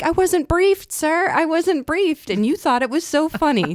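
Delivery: high, loud playful indignation; mock protest. Wife turning husband's complaint back on him, mock-indignant.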